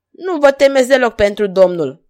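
loud, high comeback; retort. A narrator's reading. Stranger answering the king, bold retort. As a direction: retort boldly over the fight. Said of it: "ripostă necunoscutul".

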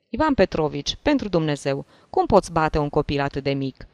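usual speaking voice

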